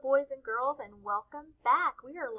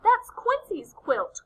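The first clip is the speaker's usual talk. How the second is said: higher, louder, slow duck voice; lightly voiced indignant duck; quacking duck; 9 semitones higher, 8 dB louder, slower than usual. A narrator's reading. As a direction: exclaim it as a duck, pitched up. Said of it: "quacks Dottie".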